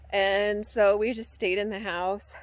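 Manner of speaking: normal talking voice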